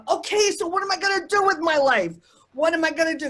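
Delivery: high, pleading outcry at the sky; shouting on an empty highway, high and pleading; retold scream. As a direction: demand an answer, half reliving it. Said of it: "I get out of the car and I scream".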